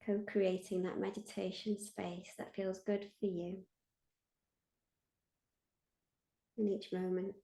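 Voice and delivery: guided meditation. Acted. Meditation leader invites listeners to feel held, guided meditation. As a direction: lead listeners gently inward, leaving space after each phrase.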